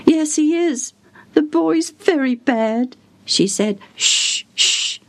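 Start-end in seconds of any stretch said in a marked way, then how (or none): 0.1-3.0 high, worried servant woman; anxious old servant. Acted. Servant answers the caller. 4.0-5.0 whispered shh; hushing. Acted. Servant hushing the caller.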